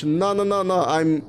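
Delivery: ordinary delivery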